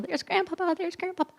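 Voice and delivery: put-on high, soft child's voice calling out; imitating little girls spotting their grandpa. Little granddaughters spot grandpa down the hill, high child's call.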